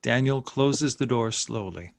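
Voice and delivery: usual speaking voice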